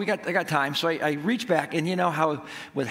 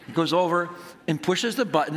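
ordinary talk, nothing marked

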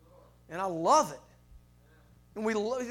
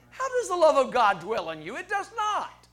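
rhetorical scripture challenge; 6 semitones higher, 8 dB louder than usual. Acted.

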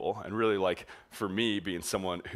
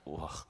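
soft, low groan of disgust; a quiet grossed-out grunt; disgusted ugh; 4 semitones lower, 11 dB quieter than usual. Retold or imitated. Sticky leg against his.

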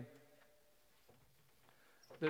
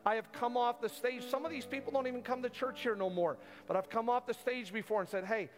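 preaching at full force; 6 semitones higher than usual. Real, not acted. Pastor pressing the church near the close, full preaching force. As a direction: drive it home to the congregation.

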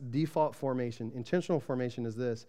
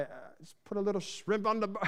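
hammed-up Australian accent, pitched up; 6 semitones higher than usual. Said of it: "Australians, they're just awesome in how they talk".

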